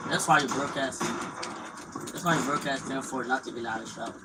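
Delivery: usual speaking voice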